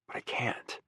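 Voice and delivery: soft unvoiced whisper. A narrator's reading. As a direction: breathe it out, defeated. Helpless inner admission.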